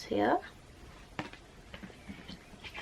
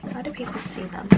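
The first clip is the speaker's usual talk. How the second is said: whisper; 3 semitones higher, 8 dB quieter, faster than usual. Real, not acted. Repeated in whisper.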